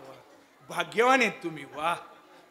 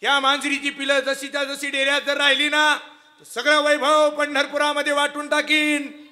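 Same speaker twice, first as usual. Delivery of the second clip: high, loud pleading daughter's call; daughter urging father; 5 semitones higher, 8 dB louder than usual. Acted. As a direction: cry out to him, urging him to pray. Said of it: "वंका नावाची मुलगी म्हणते".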